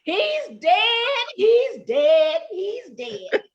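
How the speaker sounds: high, drawn-out wailing lament; hammed-up distraught cry; mock grief. A narrator's reading. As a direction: wail over a fallen friend. Rabbit finds friend apparently dead.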